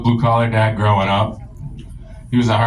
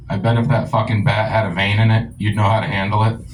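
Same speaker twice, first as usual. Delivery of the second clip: normal talking voice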